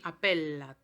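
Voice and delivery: "Appellat" with clear L plus L; Latin double L. Shown as a demonstration. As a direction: repeat "Appellat" firmly. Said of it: "suena como L más L".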